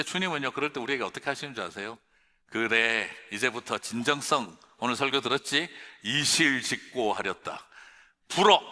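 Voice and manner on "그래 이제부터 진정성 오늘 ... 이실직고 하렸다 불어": loud mock interrogation, building to a bark; stern interrogator. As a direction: demand a confession like an old magistrate. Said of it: "막 이렇게 하시는 분이 아니에요".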